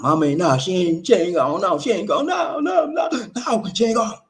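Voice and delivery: rising to a shouted no, no; frantic denial. Acted. Grieving person yelling in denial that mama is gone.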